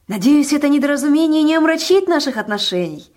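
high, loud, effusive cheer; joyful exclamation. A narrator's reading. Fat man smoothing over a misunderstanding, joyful exclamation. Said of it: "радостно воскликнул он".

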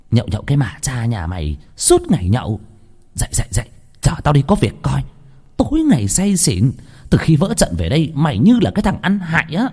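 high-pitched nagging tirade; scolding. A narrator's reading. Gang boss berating her drunken henchman, scolding.

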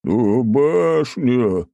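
loud, drawn-out, high bleat. Acted. Cowardly husband lamenting the tower, bleating. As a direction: bleat it out very slowly. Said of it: "заблеял Аленус".

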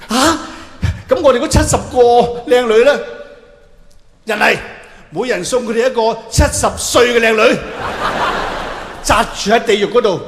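loud gleeful decree; a god gleefully dooming the martyrs. Acted. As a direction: announce the punishment loudly with relish. God's loud mock verdict.

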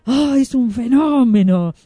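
high, slow, stretched-out marvelling; delighted exclamation. A narrator's reading. Wand-maker amazed by the flying carpet, exclaiming. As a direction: exclaim it, drawing out every stressed vowel. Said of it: "exclamó el varitero".

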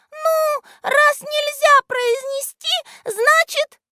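very high thin voice, slow and hesitant; тоненький голосок. A narrator's reading. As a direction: squeak it out slowly, leaving it hanging. Тот же тоненький голосок Листика-Ластика. (тоненьким голоском, неуверенно)

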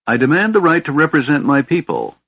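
high, proud declaration. A narrator's reading. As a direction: stand tall and demand it. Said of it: "Tasselhoff said proudly".

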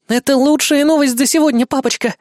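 high delighted squeal. Acted. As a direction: squeal with glee. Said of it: "завизжала она".

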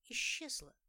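plain reading voice

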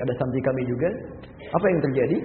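ordinary delivery

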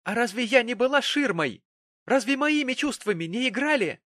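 high, loud accusing questions; shrill indignant protest; outraged reproach. Acted. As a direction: throw grievances at your father. Daughter hurls reproaches at father.

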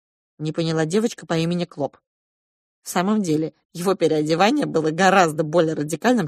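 ordinary delivery, nothing marked

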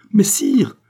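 high, loud objection; cried protest. A narrator's reading. Queen protests the king's order, crying out. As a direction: cry out to stop him. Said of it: "s'écria la reine".

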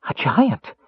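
high gasping exclamation; gasped. A narrator's reading. Kender sees the giant mage, gasping in surprise. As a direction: gasp it out in shock. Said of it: "Tass gasped".

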